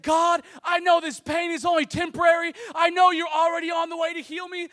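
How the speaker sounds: high, loud imitation of praying through pain; yelled prayer. Injured father-in-law crying out to God, acted high yell. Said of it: "he's yelling prayers".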